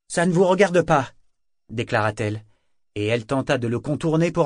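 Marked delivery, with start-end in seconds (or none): 0.1-1.1 louder, higher indignant retort; sharp defiance. A narrator's reading. Althea recovers and defies Kyle at the door, indignant. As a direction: push back at him. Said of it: "puis se reprit vivement".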